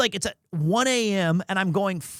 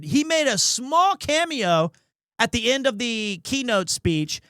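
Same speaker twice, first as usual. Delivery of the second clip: ordinary delivery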